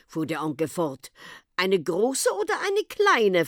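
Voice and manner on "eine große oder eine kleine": high, alarmed question; startled. A narrator's reading. Hostess hearing of a snake guest, startled. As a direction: ask it with a start of fright. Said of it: "fragte Frau Potschka in erschrockenem Ton".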